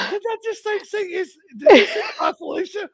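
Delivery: high-voiced mock astonishment; retold disbelief. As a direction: mimic a stunned question high in the voice. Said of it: "And Sherry's like".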